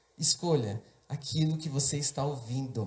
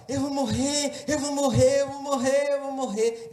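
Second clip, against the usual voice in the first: put-on high-pitched, panicked child's cry, repeated; retold scream; 9 semitones higher than usual. Preacher reenacting his childhood screams on the table, high repeated cry. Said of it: "E eu gritava".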